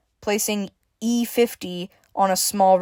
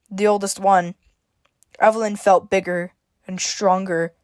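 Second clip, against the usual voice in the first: plain reading voice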